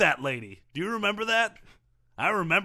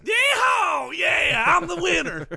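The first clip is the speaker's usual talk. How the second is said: whooping victory shout; yeehaw; 9 semitones higher, 5 dB louder, slower than usual. Acted. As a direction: holler it like a rodeo cowboy. Race winner celebrating in studio.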